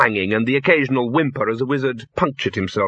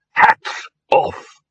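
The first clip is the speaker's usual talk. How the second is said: higher, crisp command; commanding order; 9 semitones higher, 4 dB louder than usual. A narrator's reading. Chair to wizards about hats, brisk command. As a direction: give the order to the whole group.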